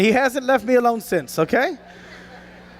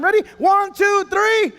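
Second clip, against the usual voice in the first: countdown call; 6 semitones higher than usual. Real, not acted. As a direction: count them in and build up. Second countdown for growl.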